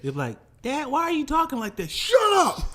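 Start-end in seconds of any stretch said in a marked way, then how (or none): 0.6-1.9 put-on child voice. Daughter's voice. 1.9-2.6 snapping. Retold or imitated. Dad snapping.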